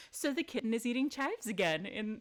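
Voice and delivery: normal talking voice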